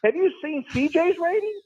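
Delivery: high, sing-song Carol Cox voice; the Jets owner suddenly turning into Carol Cox. Acted. Talk show guest slipping into a high Carol Cox voice. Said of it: "has morphed into Carol Cox".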